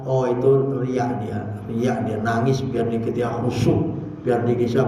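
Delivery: low, soft muttering to himself; acted private sneer, pitched well down; judgemental inner voice. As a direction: think it aloud, suspicious and hushed. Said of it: "dia dalam hati aja dia".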